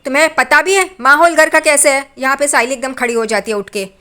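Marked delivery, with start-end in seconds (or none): 0.0-2.1 raised, louder mock scolding of the girls; imitated angry scolding. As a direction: act out an irate mother-in-law telling them off. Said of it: "ये देख के उसे गुस्सा आता है". (नकली गुस्से में ऊँची आवाज़ में डाँटना)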